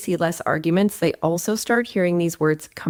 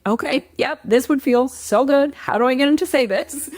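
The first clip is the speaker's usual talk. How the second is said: eager; 7 semitones higher than usual. Retold or imitated. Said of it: "And you might be like".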